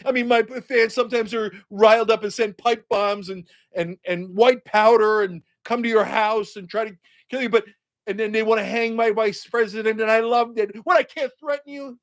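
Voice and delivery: mock his self-pity with fake outrage; mocking Trump. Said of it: "So he's like".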